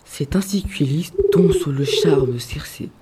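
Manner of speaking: usual speaking voice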